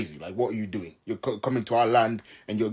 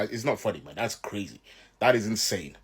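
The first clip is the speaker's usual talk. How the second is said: normal talking voice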